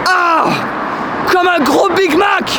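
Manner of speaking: slow, high shouted line. Real, not acted. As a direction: yell it high and drawn out. Shouting while climbing.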